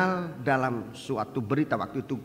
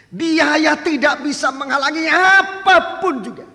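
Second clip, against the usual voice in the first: much higher, much louder pulpit proclamation; thundering sermon climax; preaching at full force; 13 semitones higher, 12 dB louder than usual. Acted. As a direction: proclaim it to the whole church, building.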